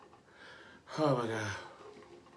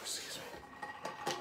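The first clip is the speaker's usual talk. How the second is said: whispered excuse me; 12 dB quieter, much slower than usual. Real, not acted. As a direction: breathe it out softly. Whispered apology.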